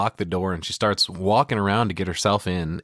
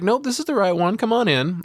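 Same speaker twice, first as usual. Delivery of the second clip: pitched-up playful come-on, lightly voiced; Baby inviting him in; 12 semitones higher than usual. Narrator voicing Baby pulling him into the room, pitched up. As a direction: purr the invite up high like Baby. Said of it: "She's like".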